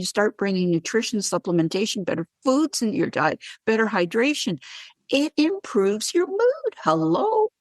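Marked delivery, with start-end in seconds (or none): 5.1-7.5 high, drawn-out sing-song of the obvious; slow, high-pitched "well, duh" delivery; playful "obviously". Real, not acted.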